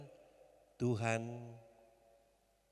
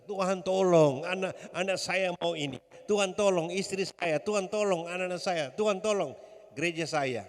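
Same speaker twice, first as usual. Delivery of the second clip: raised, higher pleading cry; crying out in prayer; 4 semitones higher, 5 dB louder than usual. Shown as a demonstration. Preacher shows congregation praying for family, crying out. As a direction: call out urgently for God's help. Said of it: "Sini katakan teriak".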